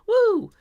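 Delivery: very high, loud woo; excited whoop. Real, not acted. Streamer cheering a tip, excited whoop. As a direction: let out a high celebratory whoop.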